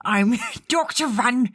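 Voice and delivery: halting attempt at an old monster hunter; Van Richten voice. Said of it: "Give me a Van Richten line".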